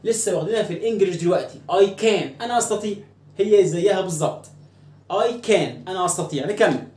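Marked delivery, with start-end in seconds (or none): none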